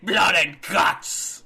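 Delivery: drawn-out British announcer shout; William Regal. Retold or imitated. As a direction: bellow it slowly in a British accent. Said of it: "William Regal's in A E W, I need to hear him say".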